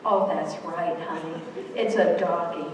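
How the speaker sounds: low, soothing mother's reply; lightly voiced mother confirming to her little one; affirming mother. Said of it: "what does the mother say".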